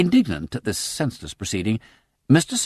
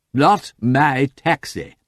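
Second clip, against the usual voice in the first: put-on slow, drunken solemnity; mock solemn; 6 semitones higher, 6 dB louder, much slower than usual. Lord Peter solemnly explains to Sugg, slow and tipsy. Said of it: "he explained solemnly".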